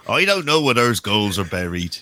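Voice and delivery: a light West Country accent, imitating the TV drama; the teasing West Country voice of an actor playing Fred West; mock Fred West accent. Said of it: "you've done a better accent than".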